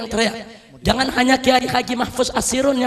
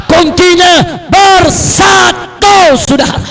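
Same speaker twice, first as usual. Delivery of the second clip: loud, impassioned oratory building to shouts; fiery preaching; 4 semitones higher, 11 dB louder than usual. Real, not acted. Preacher promises God's help to the united, fiery preaching. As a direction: thunder it out to a big crowd.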